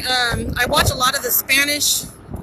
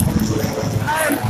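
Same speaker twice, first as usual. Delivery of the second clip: ordinary delivery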